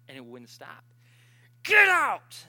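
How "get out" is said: sharp high yell of "Get out"; shouted command. Retold or imitated. Sister shouting at the spirit, high loud yell. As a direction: bark it at the spirit.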